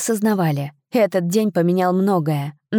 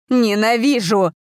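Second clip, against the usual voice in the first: hateful cry; 4 semitones higher than usual. A narrator's reading. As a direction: cry it out with pent-up rage. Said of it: "воскликнула женщина и с гневом".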